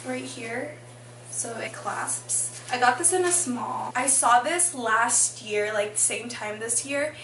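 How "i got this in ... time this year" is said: ordinary talk, nothing marked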